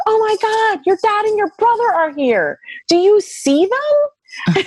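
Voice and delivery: very high, stretching out the final question; overwhelmed, can't believe they're here; astonished exclaiming. Retold or imitated. To her husband about his dad and brother, very high astonishment.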